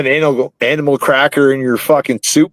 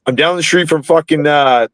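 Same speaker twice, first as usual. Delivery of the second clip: ordinary talk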